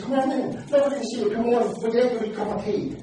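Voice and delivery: lightly voiced hostess, pitched high; welcoming hostess. Woman inviting the preacher in for tea, lightly acted welcome. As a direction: usher a visitor in with a high, lilting call.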